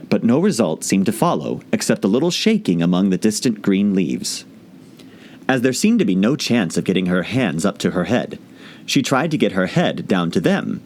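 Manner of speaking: ordinary delivery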